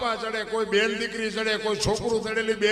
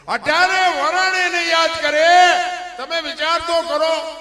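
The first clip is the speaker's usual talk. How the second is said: emphatic call; 8 semitones higher, 9 dB louder than usual. Real, not acted. Preacher stressing a point.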